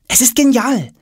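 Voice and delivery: put-on high-pitched boy's blurt; hurried praise. A narrator's reading. Tom placates father before he scolds, hurriedly. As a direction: blurt out praise to head off trouble. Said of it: "sagt er schnell".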